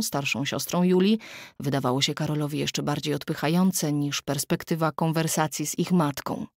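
plain reading voice

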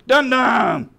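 high, loud puppet outburst; high puppet holler, falling at the end; puppet character. Acted. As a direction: blurt it out like a cheeky puppet. Puppet Mikey repeats wrong word.

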